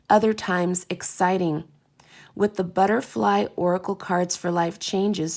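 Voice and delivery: upbeat box blurb read; reading aloud. Acted. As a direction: read it like product copy. Reads the back of the box.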